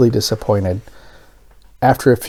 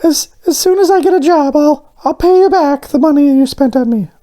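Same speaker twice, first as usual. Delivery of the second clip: much higher, eager little donut voice; Arnie the donut; 4 dB louder than usual. Acted. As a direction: play the hopeful little donut pitching ideas, up high.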